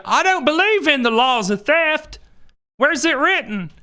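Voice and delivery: high, whiny put-on voice; mock dim-witted robber. Host mocking a robber who denies theft laws, in a high whiny voice. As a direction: play a clueless bank robber arguing with the cop. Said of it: "I say".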